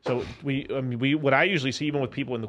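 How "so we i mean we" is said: ordinary talk, nothing marked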